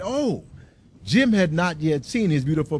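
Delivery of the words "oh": sudden high joyful cry; wife's delighted high cry. A narrator's reading. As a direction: leap into a bright exclamation. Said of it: "Della leaped up and cried".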